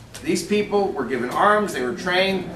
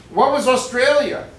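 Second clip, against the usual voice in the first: ordinary delivery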